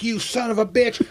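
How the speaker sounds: high, indignant mock protest; put-on high-voiced outrage; retold outrage. Retold outrage at broken deal.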